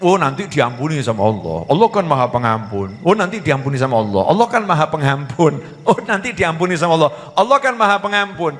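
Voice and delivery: low singsong repetition, gradually rising; mocking a wishful daydreamer; complacent daydreamer. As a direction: repeat it idly, climbing from a low pitch.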